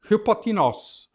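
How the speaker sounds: "hipotinos" slow and loud, ending on tinos; Greek word read slowly. Shown as a demonstration. Teacher reads the first line's word to students, slow. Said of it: "Hipotinós".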